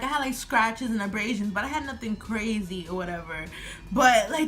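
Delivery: normal talking voice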